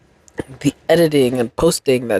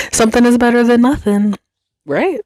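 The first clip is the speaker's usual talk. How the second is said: laughing, pitch jumping higher and faster; 9 semitones higher than usual. Real, not acted. Podcaster shrugging off her rambling to listeners, laughing. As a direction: laugh it out through the line.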